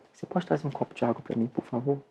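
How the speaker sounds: soft, hurried plea for water; speaking low. Shown as a demonstration. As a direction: drop your voice so the waiter has to lean in. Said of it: "você fala com a pessoa assim".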